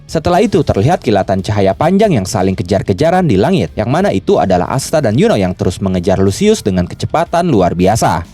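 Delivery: ordinary delivery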